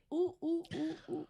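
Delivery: quiet, high monkey oohs; monkey hoots. Acted. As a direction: hoot softly like a sheepish ape. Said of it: "And the monkey said".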